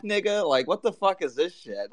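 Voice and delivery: ordinary talk, nothing marked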